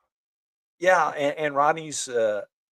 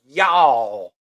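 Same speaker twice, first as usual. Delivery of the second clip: louder, drawn-out Texan y'all; imitating her y'all; 7 dB louder than usual. Mocking the candidate's opening for his co-host, folksy drawl. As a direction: give her folksy greeting a lazy drawl. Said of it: "then she's starting with".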